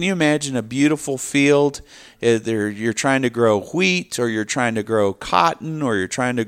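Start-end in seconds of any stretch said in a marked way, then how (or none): none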